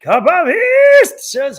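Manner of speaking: high, loud and stretched out slowly; drawn-out booming name call. Pretend. Streamer booming a viewer's name to the chat, loud and drawn out. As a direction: announce it like a game show host.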